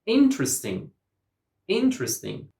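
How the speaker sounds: "Interesting" as IN-tres-ting, high on IN; "Interesting" starting high, then falling; first-syllable stress. Shown as a demonstration. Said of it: "All the native speakers say".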